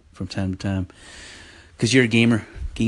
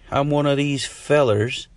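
slow, higher caller voice building to a jeer; heckling listener voice; 5 semitones higher, slower than usual. Pretend. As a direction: play a rude caller. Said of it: "You could be like".

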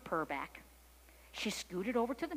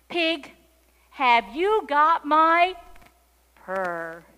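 loud, slow, raised kitten call; 6 semitones higher, 14 dB louder, much slower than usual. A narrator's reading. Kitten on the fence asks the pig, calling out loudly. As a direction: call the question out with big volume. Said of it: "she called".

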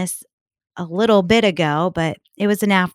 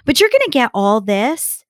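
usual speaking voice

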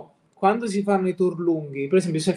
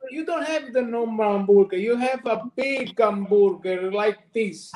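Sicilian accent; 4 semitones higher than usual. Shown as a demonstration. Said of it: "dillo in inglese come lo dici in concerto".

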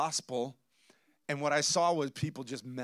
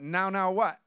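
higher, louder, challenging now what; mock indignant retort; 5 semitones higher, 5 dB louder than usual. Pastor retelling his retort to the cashier, mock indignant. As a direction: snap back at the cashier, half-joking. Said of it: "and I went".

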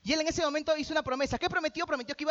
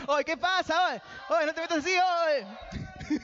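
put-on neighbourhood thug, raised voice; street-tough character; 2 semitones higher, slower than usual. Preacher playing his character to scare off robbers, street-tough swagger. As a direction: act the local tough warning someone off. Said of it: "me metí en personaje".

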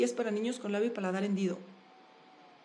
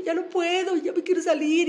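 higher, louder desperate cry to get out; retold shout; 5 semitones higher, 7 dB louder than usual. Freezing swimmer shouting from the water that she quits, a loud high cry. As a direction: yell that you can't go on any more. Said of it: "y lo grito".

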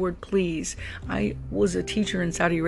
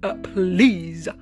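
put-on slow, drawn-out Valley Girl ask, louder; 6 dB louder, much slower than usual. Shown as a demonstration. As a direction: stretch it out Valley Girl style, rude but polite. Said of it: "a Valley Girl kind of way".

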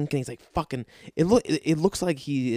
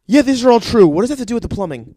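exasperated outburst; 10 semitones higher, 11 dB louder, faster than usual. Retold or imitated. Speaker's exasperated protest in story.

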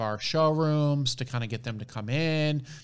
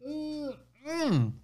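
high savoring hum; knowing hum over hot tea; sipping hot tea; 13 semitones higher, 4 dB quieter than usual. Real, not acted. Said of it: "let me take a sip of this tea".

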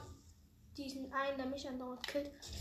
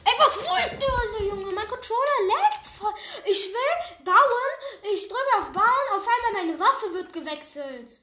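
loud, high, laughing angry rant; angry shouting; 8 semitones higher, 11 dB louder than usual. Real, not acted. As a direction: yell at the laggy controller. Angry gamer ranting at teammates.

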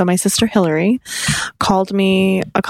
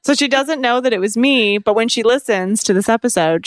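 ordinary talk

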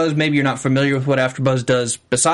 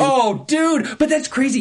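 high-pitched fan excitement; excited; 10 semitones higher than usual. Real, not acted. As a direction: gush like a thrilled fan. Gushing over favourite TV couple.

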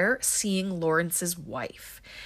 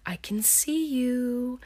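high, drawn-out whispery taunt; creepy slow singsong; 5 semitones higher, much slower than usual. Retold or imitated. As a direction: taunt them slowly in a high, hushed voice. Creepy killer taunting on screen.

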